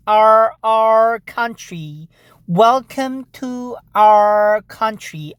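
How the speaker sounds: slow talk for someone who barely knows English; very slow, spaced-out welcome; slow, careful enunciation. Acted. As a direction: draw each word out slowly, as to a foreigner. Speaking to a supposed foreigner.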